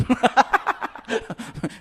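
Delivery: breathy high burst of laughter. Real, not acted. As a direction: laugh at your own joke. Laughs at song lyric. (tawa kecil)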